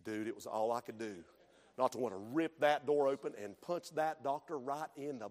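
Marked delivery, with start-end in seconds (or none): none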